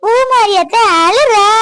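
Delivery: high, loud, drawn-out tattling; singsong teasing. Acted. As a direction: call it out slowly like a tattling child. Teasing announcement that aunt cries. (ராகம் இழுத்து கேலியாக)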